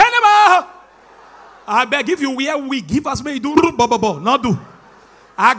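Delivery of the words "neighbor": high, loud call of Neighbor. Shown as a demonstration. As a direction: belt Neighbor out to the crowd.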